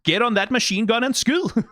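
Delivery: imitating an excited Danish-accented soldier. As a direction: call it out like a Danish game voice actor. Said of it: "Danish voice actors in, and they did".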